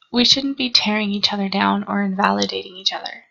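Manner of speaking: ordinary talk, nothing marked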